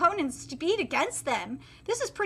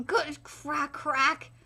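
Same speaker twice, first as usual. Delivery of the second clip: usual speaking voice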